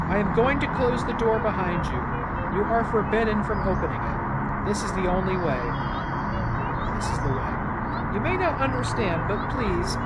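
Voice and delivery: put-on firm but pleading, a mother locking her child away; stern mother. Voices Mother figure reading game text.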